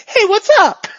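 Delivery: high, loud, bouncy hello; cheery reset greeting. Acted. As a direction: snap back into an oblivious, friendly greeting. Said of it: "and then he blurs".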